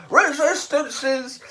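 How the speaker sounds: high, loud, slow bellow. A narrator's reading. Vogon guard bellows his favourite slogan, very loud. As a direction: fill the lungs and roar it. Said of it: "filled his lungs and bellowed".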